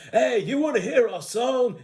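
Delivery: high, swooping put-on dude voice; imitated movie dudes. Buffed-up prison versions offering their song, hammed-up dude voice.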